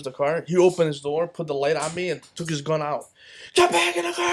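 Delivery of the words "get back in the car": high-pitched yelled command, little added volume; imitating a screamed police order; retold screaming cop. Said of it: "So screaming".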